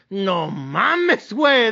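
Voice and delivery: slow, stretched "no mames way"; drawn-out disbelief. Real, not acted. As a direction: groan it out in disbelief. Reacting to lost bet.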